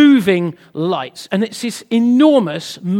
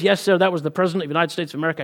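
brisk American policeman; American accent; 4 semitones lower, 3 dB quieter, faster than usual. Acted. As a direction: rattle it off in an American cop's accent. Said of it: "a bit more of an American accent involved".

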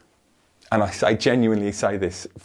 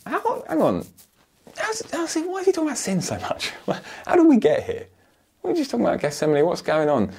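much higher and fast, confused congregation member; puzzled listener; 14 semitones higher, faster than usual. Acted. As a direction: voice a baffled listener who lost the thread. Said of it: "You might be thinking".

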